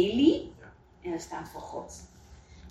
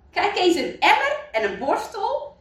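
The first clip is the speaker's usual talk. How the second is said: much higher, delighted; pleased mayor; 10 semitones higher than usual. Acted. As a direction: show off the find with glee. Mayor finds bucket and brush. (veel hoger, verrukt)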